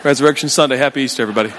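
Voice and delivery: low, suddenly calm Easter greeting; mock-serene hello to the congregation; composed church greeting. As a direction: switch to pious composure, voice dropped. Acted sudden composure arriving at church.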